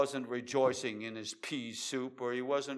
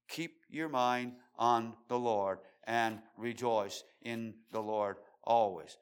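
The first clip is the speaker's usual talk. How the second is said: normal talking voice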